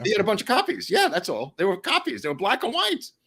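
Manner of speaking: high-pitched, animated insistence; insistent correction. Real, not acted. Explaining the photostats to the co-host, insistent correction.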